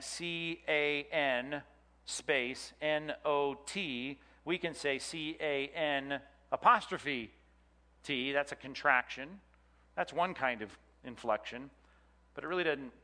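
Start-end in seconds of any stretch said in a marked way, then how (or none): 0.0-8.3 C A N spelled letter by letter, then N O T drawn out slowly; spelling out letters. Shown as a demonstration. Teacher spelling out can not to show a contraction, slow letters. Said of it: "instead of saying".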